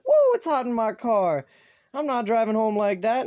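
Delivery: high, loud whoop into complaint; loud retold exclamation. Telling viewers about the hot car, loud and high. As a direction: call it out high, replaying the moment.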